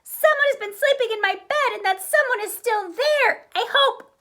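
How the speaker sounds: very high, loud squeal. Acted. Baby Bear finds someone in his bed and squeals very high. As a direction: squeal it out like an excited cub. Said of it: "Baby Bear squealed".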